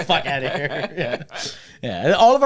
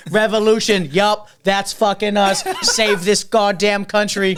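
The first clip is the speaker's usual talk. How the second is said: loud, high, suddenly devoted Boston fan; Jerry; 7 semitones higher, 6 dB louder, slower than usual. Retold or imitated. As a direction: champion the home team in a Boston accent. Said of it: "He was, like, all about it".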